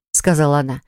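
plain reading voice

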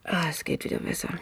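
soft, spent whisper of relief; exhausted relief. Acted. Lena tells friends the attack is over, spent. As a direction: breathe out as the panic passes.

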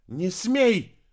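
high, loud forbidding cry; shouted protest. A narrator's reading. Girl intervening against the heir, shouting. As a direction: shout to stop the attack. Girl cries out to stop killing.

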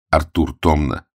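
normal talking voice

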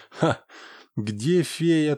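usual speaking voice

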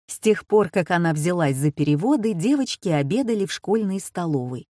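ordinary delivery

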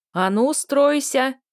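loud slow bark; barking shout. A narrator's reading. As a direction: bark the order like a sergeant. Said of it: "гаркнула Мариша". (гаркнуть во весь голос)

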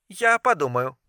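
soft, noncommittal female voice; guarded reply. A narrator's reading. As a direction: concede quietly after a silence. Naomi answers after a tense silence. (тихо, сдержанно)

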